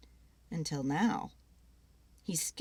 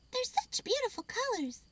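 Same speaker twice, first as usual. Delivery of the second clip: high, smiling squirrel voice; pleased little squirrel. Acted.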